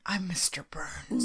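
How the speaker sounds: slow, eerie whisper; repeated ghostly whisper. Retold or imitated. Ghost repeating his name over and over, ghostly whisper. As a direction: whisper it as a haunting refrain. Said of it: "he said it over and over again".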